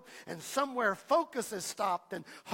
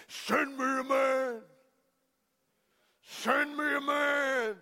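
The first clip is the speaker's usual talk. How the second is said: booming giant's call; 3 semitones higher, slower than usual. A narrator's reading. Giant calling from the valley, slow booming shout. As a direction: roar it out like a giant daring the army. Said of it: "the call comes out from the valley".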